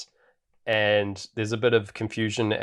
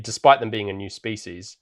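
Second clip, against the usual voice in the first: normal talking voice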